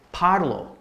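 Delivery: "parlo" with the first syllable stressed; stress demonstration. Shown as a demonstration. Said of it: "your stress is always gonna be".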